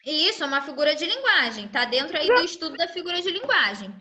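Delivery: ordinary talk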